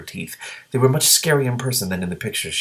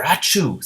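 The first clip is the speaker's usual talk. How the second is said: sneeze; 7 semitones higher than usual. Acted. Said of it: "said the gray green dragon".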